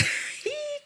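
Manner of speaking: very high, soft giggle; short giggle. Real, not acted. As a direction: let out a small high laugh. Laughs while losing her thread.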